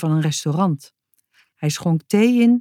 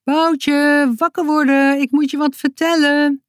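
wake-up call; 8 semitones higher than usual. A narrator's reading. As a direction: call out high to wake someone. Sisi wekt Boutje roepend. (hoog roepend wekken)

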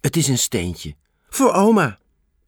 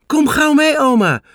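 1 eager call; 12 semitones higher, 5 dB louder than usual. A narrator's reading. Girl remembers where her bag is.

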